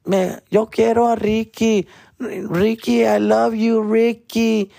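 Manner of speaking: high mock fangirl voice swooning over Ricky; fangirl sister. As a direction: gush like a lovestruck fifth-grade fan, pitched high. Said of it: "She would be like".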